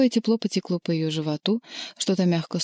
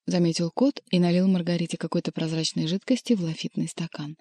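usual speaking voice

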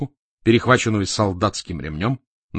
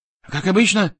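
mildly scared, higher-pitched reply; frightened; 7 semitones higher, 3 dB louder than usual. A narrator's reading. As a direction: answer with a touch of fright. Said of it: "испуганно ответил Марковин". (слегка испуганно)

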